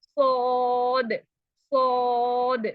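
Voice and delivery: सौद stretched very slowly, voice aimed upward; drawn-out saad. Shown as a demonstration. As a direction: hold सौद long and heavy. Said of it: "आवाज का रुख ऊपर की तरफ". (धीमे खींचकर सौद)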